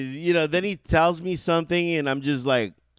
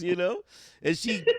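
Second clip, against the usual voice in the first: ordinary talk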